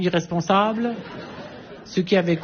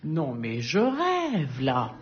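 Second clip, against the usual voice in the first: incrédule; 5 semitones lower, 6 dB quieter, much slower than usual. Pretend. Incrédule devant la scène.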